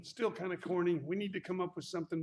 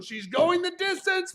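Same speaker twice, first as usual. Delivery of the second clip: much higher, louder cheering; excited cheer; 11 semitones higher, 9 dB louder than usual. Real, not acted. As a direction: cheer her on like an announcer. Cheering on jumper.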